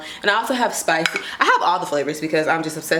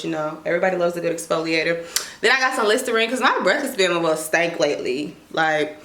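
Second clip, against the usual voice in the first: ordinary talk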